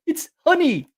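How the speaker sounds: very high, excited movie-character quote; Thirteenth Warrior character. Retold or imitated. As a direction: imitate a film hero's eager cry. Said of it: "he's like".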